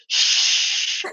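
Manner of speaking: unvoiced shush at arguing kids; sharp parental shush; emphatic shush. Shown as a demonstration. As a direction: hush the kids firmly. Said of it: "you tell them to go".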